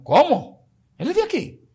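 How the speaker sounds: very high-pitched mimicked disbelief; astonished. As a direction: imitate stunned visitors, voice shooting up. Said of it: "No se asombren". (asombro imitado, con voz muy aguda)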